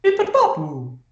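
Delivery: high nonsense Italian babble; silly, very high mock-Italian gibberish. Shown as a demonstration. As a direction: parody Italian in bouncy scat syllables. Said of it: "stereotypical Italian accent was".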